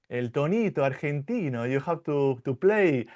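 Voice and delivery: a light Argentinian lilt on el tonito argentino; Argentinian accent. Shown as a demonstration. Teacher showing his Argentinian Spanish tune, lightly Argentinian. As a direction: sing el tonito argentino the Buenos Aires way. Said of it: "I have an Argentinian accent".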